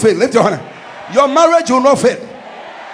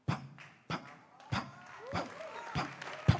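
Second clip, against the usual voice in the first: whispered sound effect; 25 dB quieter than usual. Pretend. Mimics typing out solutions.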